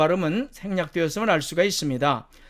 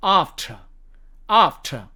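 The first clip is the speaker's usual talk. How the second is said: British "after", long ah and silent r; British demo of "after", r dropped; British accent; much slower than usual. Shown as a demonstration. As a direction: say "ahf-tuh" for "after", British style. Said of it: "영국식 발음으로는".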